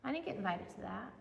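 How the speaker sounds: soft, quiet, let-down mock reaction; deflated aside. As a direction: trail off as if quietly hurt. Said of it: "you're like".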